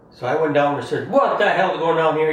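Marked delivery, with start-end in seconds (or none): 1.1-2.3 loud, sharp call down the stairs; retold shout. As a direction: bark it at the dogs, raised voice. Shouting at scared dogs.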